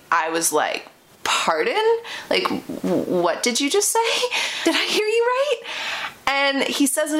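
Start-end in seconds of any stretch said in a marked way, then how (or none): 1.2-5.6 high, incredulous pardon, with a laugh at the end; shocked disbelief. Retold or imitated. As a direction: sound stunned at what he just said. Reacting to his rude comment.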